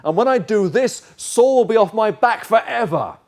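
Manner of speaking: loud, high, emphatic outburst; desperate resolve. Acted. Preacher voices the pushing voice in your head, desperate and loud. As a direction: throw it out as a defiant decision at full volume. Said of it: "To say".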